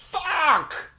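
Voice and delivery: high, drawn-out cry of "fuck"; exasperated curse. Retold or imitated. Man reacting to a ticket price, exasperated cry. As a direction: wail the swear word in dismay.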